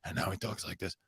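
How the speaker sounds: hushed, worn-down smoker's voice; Al Pacino's smoker voice. Acted. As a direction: drop it right down like a longtime smoker. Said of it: "he used to have that high voice".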